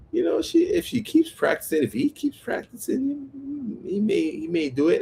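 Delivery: high-pitched judge giving a hedged verdict; imitated judge. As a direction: play the unsure judge in a high voice.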